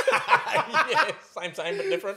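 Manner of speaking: loud, high, through real laughter; laughing hard. Real, not acted. Defending a midnight screening at one A M, laughing hard. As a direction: crack up while protesting your own mistake.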